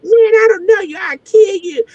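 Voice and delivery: high, tearful imitated threat; mock crying threat. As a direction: mimic him sobbing out a threat.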